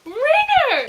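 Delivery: high, loud wailed name; anguished cry. Real, not acted. Reader crying out to Ringer, high and loud.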